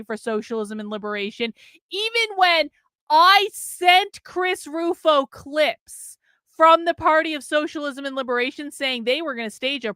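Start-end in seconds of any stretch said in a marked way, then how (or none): 3.1-6.9 slow, loud, higher, each word leaned on; emphatic. Real, not acted. To viewers about Rufo ignoring him, slow emphatic stress. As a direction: hammer it home word by word. Stressing his own reporting.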